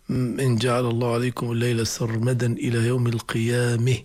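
level-pitched recitation, "القيامة" ending in eh; imala at stopping. Shown as a demonstration. Teacher demonstrates imala, "القيامة" stopped as qiyameh. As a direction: recite evenly, tilt the last vowel of "القيامة" to e. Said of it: "اسمه الإمالة".

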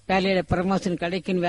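ordinary delivery

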